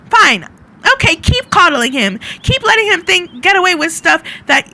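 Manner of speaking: high, loud angry shout in character; flustered shouting. Acted. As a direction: shout it back at family behind you. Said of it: "shouting behind her".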